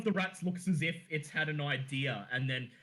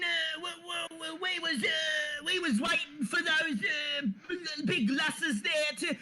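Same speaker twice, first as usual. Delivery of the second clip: high, whiny Cockney rat in a flap; squeaky Cockney rat voice; panicky Cockney rat; 10 semitones higher than usual. Acted. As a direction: wail like a fretting street rat. Rat voice answers.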